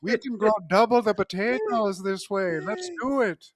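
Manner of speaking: high, hammed-up Irish inventor; jokey Irish accent, bursting with pride. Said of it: "the Irish".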